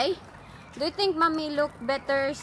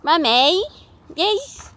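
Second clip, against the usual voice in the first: mimicking daughter's accent with rising questions; playful copy of a child's accent; Jessica's accent; 5 semitones higher, 6 dB louder, much slower than usual. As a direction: put on your kid's accent, loud and lilting. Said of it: "mommy's accent same as yours".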